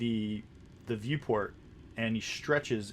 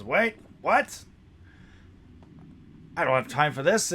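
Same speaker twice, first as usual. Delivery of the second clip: higher, louder, put-upon voice; a busy captain, annoyed at the interruption; irritated captain; 4 semitones higher, 5 dB louder than usual. Acted. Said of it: "he says".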